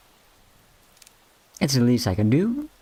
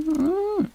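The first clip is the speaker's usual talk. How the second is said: high hum. Acted. Reacting to being called out of it.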